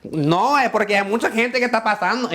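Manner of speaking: high, loud, rapid-fire Spanish character voice; Sofia Vergara. Acted. Student getting into the character, mimicking a Colombian actress loudly. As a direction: throw yourself into an excitable Colombian woman. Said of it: "have you mimic Sofia Ve".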